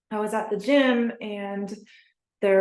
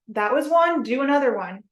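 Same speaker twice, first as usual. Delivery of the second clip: upbeat, higher and louder encouragement; excited coaching; 5 semitones higher, 5 dB louder than usual. Retold or imitated. Coaching a gym friend through handstands, excited and upbeat. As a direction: cheer her on to the next rep.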